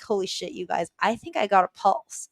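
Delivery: usual speaking voice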